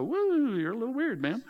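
a light mock surprise at a weird guy; taken aback. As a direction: recoil from a wild-looking stranger. Modern folks meeting John.

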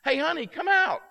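high, loud holler to someone out back. Retold or imitated. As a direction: call your wife out from the back. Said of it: "he hollers to his wife".

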